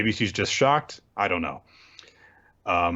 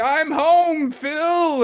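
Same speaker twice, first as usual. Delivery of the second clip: much higher, gushing reunion squeal; high squeaky Emma; 4 dB louder, much slower than usual. Retold or imitated. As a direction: squeal it like a delighted kid. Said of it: "And she's like".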